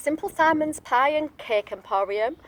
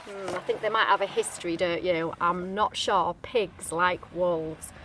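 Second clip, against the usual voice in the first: ordinary delivery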